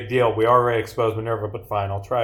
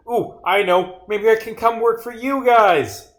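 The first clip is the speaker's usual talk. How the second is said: much higher, louder, gushing suggestion; excited brainwave; 10 semitones higher, 4 dB louder than usual. Acted. Jobless character asking detectives for work, excited. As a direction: pitch yourself with sudden glee.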